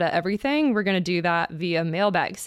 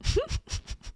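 laughing; 5 dB quieter than usual. Real, not acted. Reacting to the listener question.

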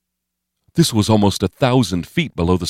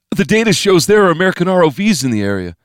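high, fast protest; panicky warning; 11 semitones higher, 4 dB louder, faster than usual. A narrator's reading. Steve warning of American ROVs nearby, panicky and fast. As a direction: rush the excuse out, pitch way up.

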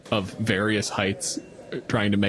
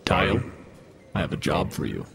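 low, menacing demon boss; deep devil voice; 5 semitones lower, faster than usual. Acted. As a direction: speak down to him like a devil. Devil addresses the new recruit.

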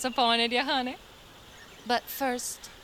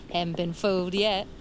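ordinary talk, nothing marked

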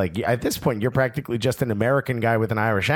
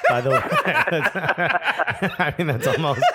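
laughing; 6 semitones higher, faster than usual. Real, not acted. Laughing at the joke.

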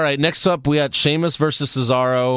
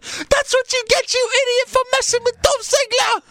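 very high squeaky mock kid yell; little kid yelling. Retelling a kid's heckle at a wrestler, high mock yell. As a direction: imitate a small child heckling in falsetto. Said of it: "hear the little kid yell at".

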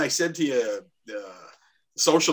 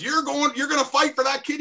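taunting; 12 semitones higher than usual. Retold or imitated. Opponent taunting in penalty box.

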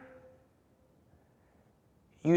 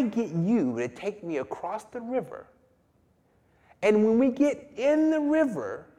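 scorpion talking the frog round, pitched higher; persuading scorpion; 8 semitones higher than usual. Acted. Scorpion persuading the frog to carry him across. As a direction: coax him, it makes perfect sense. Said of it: "And the scorpion says".

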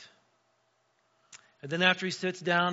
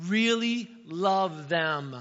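slow, deliberate, pressed emphasis; earnest emphasis; 2 semitones higher, 5 dB louder, much slower than usual. Real, not acted. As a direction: lean hard on really. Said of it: "No, no, no".

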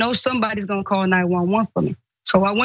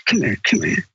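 gruff man; 5 semitones lower than usual. Retold or imitated. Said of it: "he kept saying".

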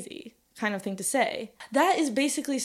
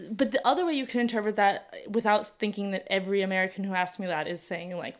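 ordinary talk, nothing marked

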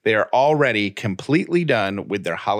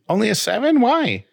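surprised; 11 semitones higher than usual. Real, not acted. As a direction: jump up in pitch in disbelief. Wife rates week only seven.

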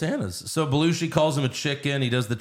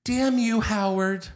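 mock wife; 8 semitones higher than usual. Podcaster voices Liz cursing Howard, mocking and laughing. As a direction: huff it like a fed-up wife. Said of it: "she goes".

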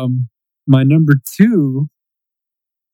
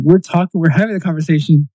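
normal talking voice

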